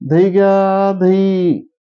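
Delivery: hawking curd to the houses; street hawker's call. Retold or imitated. Curd seller calling her wares outside the window, hawker's call.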